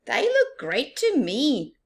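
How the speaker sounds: high, loud and bright-hearted; cheerful Baboon. Acted. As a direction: reassure your friend. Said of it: "replied Baboon".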